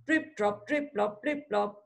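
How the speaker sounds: soft, evenly spaced imitated drips; dripping noise. Shown as a demonstration. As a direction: tick out each syllable like falling drops. Imitating rain dripping through roof.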